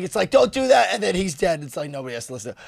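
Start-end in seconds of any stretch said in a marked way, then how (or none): 0.3-0.9 put-on high, loud old man's yell. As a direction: bark it like a cranky old man. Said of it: "the old man that's yelling".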